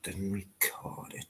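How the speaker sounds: slow, breathless whispered echo of Didn't record it. Real, not acted. Muttering to himself about not recording the call, slow whisper. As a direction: whisper it slowly to yourself, like a regretful aside. Repeats line then whispers it.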